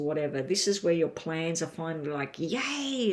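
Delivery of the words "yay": excited. Acted. Plans finally happening cheer.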